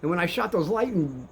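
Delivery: usual speaking voice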